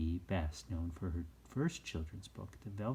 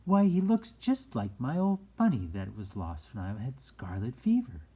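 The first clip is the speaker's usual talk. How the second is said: put-on boy's thought; 2 semitones higher, 7 dB louder than usual. A narrator's reading. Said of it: "the boy thought to himself".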